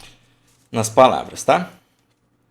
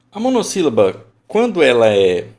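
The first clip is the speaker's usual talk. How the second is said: loud emphasis; 2 semitones higher, 8 dB louder than usual. Shown as a demonstration. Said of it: "teacher emphasizing".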